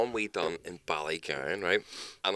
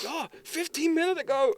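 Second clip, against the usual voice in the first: very high squeak; mock takeaway worker. Takeaway worker answers the fake cop, very high.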